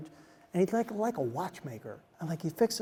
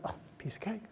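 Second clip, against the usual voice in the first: breathy aside under the breath; whispered thought; 3 semitones higher, 11 dB quieter than usual. Retold or imitated. Speaker privately memorizing the name, whispered. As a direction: whisper it to yourself. Thinking to himself.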